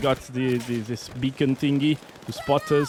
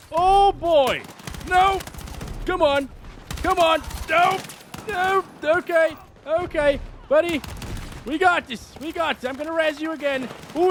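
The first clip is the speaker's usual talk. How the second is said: frantic excited yelling; 11 semitones higher than usual. Real, not acted. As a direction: yell it out as the firefight goes wild. Frantic firefight, yelling to teammate.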